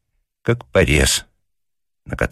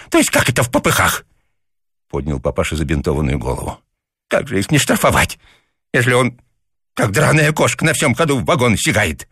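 put-on indignant old man; 6 semitones higher than usual. A narrator's reading. Papasha indignant.

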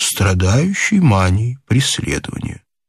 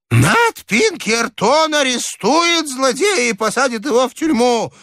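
fixated high-pitched chant of hope; obsessed old man. A narrator's reading. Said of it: "опять пробормотал своё старик".

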